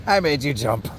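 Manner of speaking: ordinary delivery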